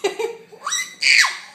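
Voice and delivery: loud rising burst of laughter. Real, not acted. Mom laughing loudly at the baby.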